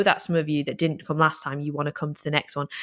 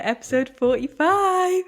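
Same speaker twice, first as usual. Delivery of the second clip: excited; 12 semitones higher than usual. Real, not acted. Excited show-opening announcement.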